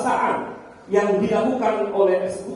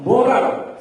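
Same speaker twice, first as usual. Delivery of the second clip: ordinary talk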